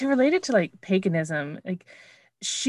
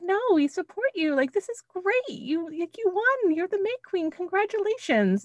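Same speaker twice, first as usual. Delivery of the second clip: much higher, sugary cheerleading voice; sweet supportive chorus; 13 semitones higher than usual. Retold or imitated. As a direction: coo praise at the new May Queen. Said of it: "the community's like".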